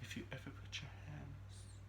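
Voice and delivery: soft, eerie whispered threat; crazy voice. Retold or imitated. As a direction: breathe the threat like an unhinged villain. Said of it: "In her crazy voice".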